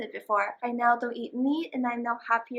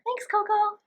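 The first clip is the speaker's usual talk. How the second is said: cute voice; 10 semitones higher, 4 dB louder than usual. Acted. Thanking the dog in cute voice.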